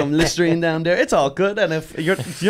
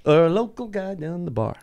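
mock [name] voice; 7 semitones higher than usual. [name] voiced asking lover.

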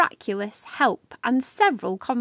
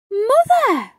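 high, loud startled cry; shocked to see who is at the door; shocked exclamation; 10 semitones higher, 6 dB louder than usual. Acted. Madame Miraculous opening the door to find her mother, shocked cry. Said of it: "Madame Miraculous exclaimed".

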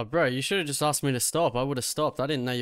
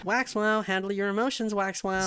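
higher lisping nerd mockery; Maxwell; 6 semitones higher than usual. Needling the officer about his emotions, mock nerd voice. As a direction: scold in a put-on lisping nerd voice. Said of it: "It's the Maxwell accent".

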